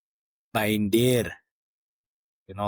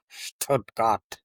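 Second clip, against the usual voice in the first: Stuttgart with a German accent; a hint of German accent on Stuttgart; mock German accent. Shown as a demonstration. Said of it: "once I start my German accent".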